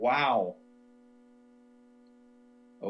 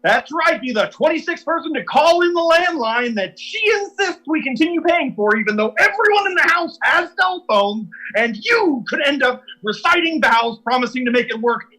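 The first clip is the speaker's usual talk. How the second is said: high, loud, hammed-up radio DJ shouting a contest; shouted radio giveaway ad; 11 semitones higher, 13 dB louder than usual. Bitter husband hyping a call-in contest to win his wife, shouted DJ style. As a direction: blast it like a desperate radio promo.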